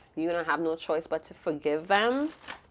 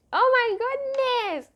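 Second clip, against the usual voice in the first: very high, drawn-out exclamation; amused shock; slower than usual. Real, not acted. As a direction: squeal it slowly in delighted shock. Reacting to a sexual card.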